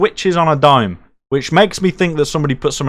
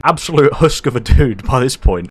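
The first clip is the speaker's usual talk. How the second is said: normal talking voice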